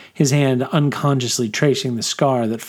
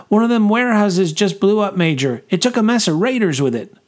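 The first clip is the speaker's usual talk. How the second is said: higher, urgent soldier voice; excited young corporal; 9 semitones higher than usual. A narrator's reading. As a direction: report breathlessly to the major. Sooty corporal reports to major.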